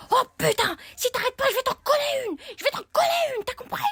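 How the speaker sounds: put-on threatening boy. Boy threatening the girl, loud mock fury. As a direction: bark the threat at her. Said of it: "il m'a dit".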